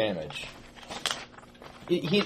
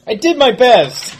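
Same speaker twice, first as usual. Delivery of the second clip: loud whine; 10 semitones higher, 12 dB louder, slower than usual. Acted. Character replies to mocking outlaw.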